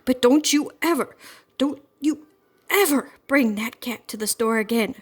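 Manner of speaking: put-on mother scolding the girls, words breaking off; angry scolding. A narrator's reading. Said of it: "She was so angry she could hardly speak".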